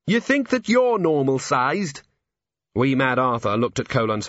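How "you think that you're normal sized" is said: higher, slow and incredulous; man doubts the tiny gnome, incredulous; disbelieving. A narrator's reading. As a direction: question it in disbelief. Incredulous retort.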